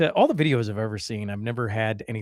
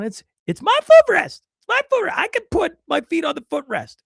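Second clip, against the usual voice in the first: high, whiny mock voice of the angry passenger; 6 dB louder than usual. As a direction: squeal it like a petulant man, much higher and louder at first. Angry passenger quoted in story.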